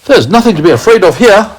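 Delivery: high, fast centipede voice; brash bug voice, quick and high; lightly voiced cheeky centipede. A narrator's reading. Centipede character.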